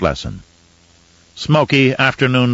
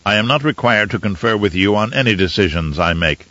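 usual speaking voice

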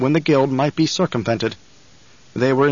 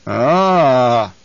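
pleased exclamation; 4 dB louder than usual. Acted. Baron hears Hawat captured.

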